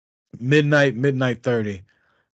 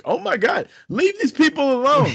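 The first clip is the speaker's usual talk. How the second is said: embarrassed protest; 12 semitones higher than usual. Retold or imitated. Begging his drunk friend to leave the wrestlers alone, high embarrassed plea. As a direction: beg her to stop, voice climbing high. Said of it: "I'm like".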